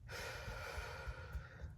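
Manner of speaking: long unvoiced sigh of despair; heavy sigh. Real, not acted. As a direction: let out a long, weary sigh. Heavy sigh amid grief.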